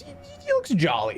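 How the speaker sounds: ordinary delivery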